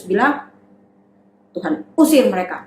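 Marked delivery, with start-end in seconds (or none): none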